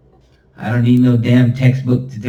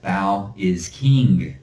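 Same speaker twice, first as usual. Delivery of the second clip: slow reveal; much slower than usual. Retold or imitated. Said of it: "it says".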